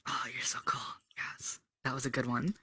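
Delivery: whispered admiration. Real, not acted. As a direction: breathe it out softly. Admiring aside.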